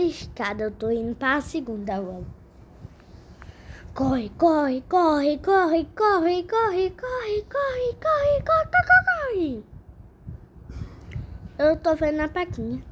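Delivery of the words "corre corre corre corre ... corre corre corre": frantic chant climbing much higher; while running. Pretend. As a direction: yell him on, faster, higher. Urging the character up the stairs.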